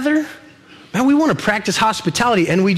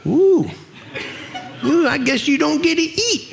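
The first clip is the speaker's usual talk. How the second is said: higher, teasing parent with a laugh; mock parent; 7 semitones higher than usual. Dad teasing a child who gripes about dinner, laughing. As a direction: tease the griping kid, laughing. Parent answering griping kid.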